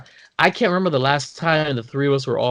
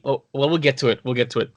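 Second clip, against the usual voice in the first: usual speaking voice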